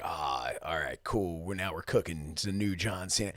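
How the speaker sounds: soft, low imagined crowd reaction; hushed, easygoing mock approval; low-key approval. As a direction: murmur it like fans quietly coming round. Imagined fans' mild approving reaction.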